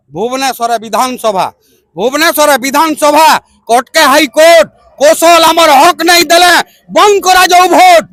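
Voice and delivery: declaimed verse, swelling to ringing peaks and dropping back; fiery protest poem. Acted. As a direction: rouse the crowd with rhythmic, forceful lines. Recites protest poem at full force. (ଜୋସଭରା ପ୍ରତିବାଦୀ କବିତା ଆବୃତ୍ତି)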